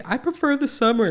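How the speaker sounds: high mocking voice of summer lovers; mocking imitation. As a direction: parody them, high and whiny. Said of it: "people who say".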